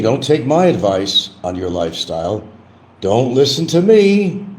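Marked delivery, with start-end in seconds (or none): 3.0-4.4 very high, loud, drawn-out singsong; sing-song catchphrase. Pretend. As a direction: belt it high and hold the last word. Repeats mock catchphrase.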